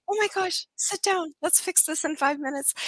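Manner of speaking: high, eager retold call to sit down; retold excitement. Coach telling the nurse to sit for a quick fix, excited. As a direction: replay your thrill at the easy fix.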